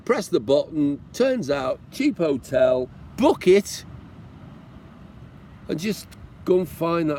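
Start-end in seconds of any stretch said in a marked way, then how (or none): none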